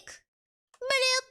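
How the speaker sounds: high squeaky bloop noise; playful high bloop; silly bloop. Pretend. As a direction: pop out a cartoon bloop. Silly sound effect.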